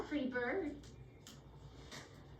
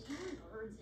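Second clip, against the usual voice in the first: put-on old man voice; 3 semitones lower, 8 dB quieter than usual. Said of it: "in this old man voice".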